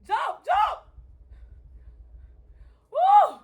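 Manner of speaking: high, loud cheering scream. Real, not acted. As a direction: scream him down the field. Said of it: "for screaming in y'all ear".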